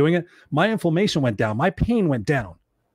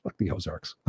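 muttered dismissal; 11 semitones lower, 12 dB quieter than usual. Real, not acted. Dismissive aside.

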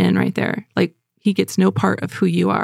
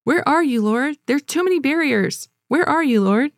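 high, plaintive cry to God; self-pitying plea; 6 semitones higher than usual. Retold or imitated. Preacher voicing her own self-pity, a raised plaintive plea. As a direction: plead as if abandoned. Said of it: "One of my self-pity statements I'll say is".

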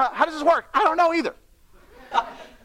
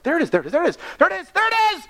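rising higher with each there it is; building delight, climbing up; growing thrill of finding it everywhere; excited discovery; 7 semitones higher, faster than usual. Retold or imitated.

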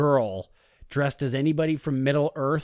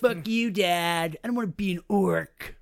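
lightly voiced defiant kid orc, pitched up; rebellious orc son; 6 semitones higher than usual. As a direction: play a mock-petulant son talking back to Dad. Voicing rebellious young good orc.

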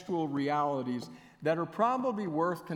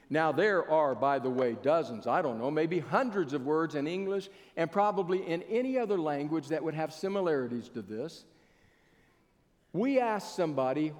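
ordinary talk

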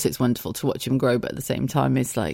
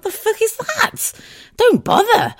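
much higher, mock-outraged disbelief; incredulous scoff; 3 dB louder, slower than usual. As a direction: scoff at the tiny glass of water. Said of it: "I'd be like".

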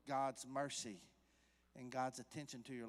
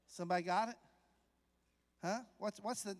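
ordinary talk, nothing marked

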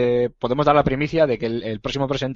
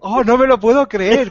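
mock disbelief; 8 semitones higher, 4 dB louder than usual.

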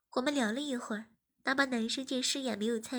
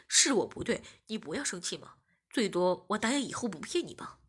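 deeper, pleading sorry; lower apologetic boyfriend; 4 semitones lower, 3 dB louder than usual. Acted. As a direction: own up and coax. (低声认错)

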